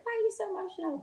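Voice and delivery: lightly mocking his question; imitating him. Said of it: "He's like".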